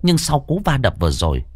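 tense, lively storytelling voice; horror story narration. A narrator's reading. Narrator telling a ghost story to listeners, tense storytelling. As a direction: pull listeners into the haunted boarding house.

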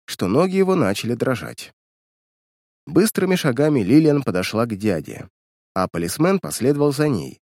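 plain reading voice

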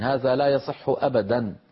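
ordinary talk, nothing marked

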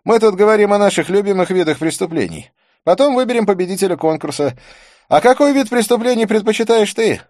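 high, loud voice for a detective; animated detective character. Acted. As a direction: pitch it high and loud, addressing the table.

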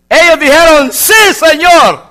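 loud, high, drawn-out reply with echo; booming echoed "Sí, Señor"; echoing shout. Acted. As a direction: call it out like an echo in a hall. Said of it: "Le pone hasta eco ahí".

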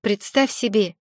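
ordinary delivery

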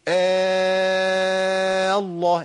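very long level "aa" held before الله; long madd. Shown as a demonstration. Teacher showing the substituted alif, long madd into الله. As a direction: sustain آه steadily, then close into الله. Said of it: "بعد حرف المد الألف".

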